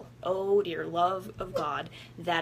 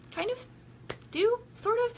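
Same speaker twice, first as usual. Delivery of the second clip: much higher, teasing take-back; sarcastic hedge. Real, not acted. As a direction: undercut the kind wish playfully. Sarcastic after I wish them well.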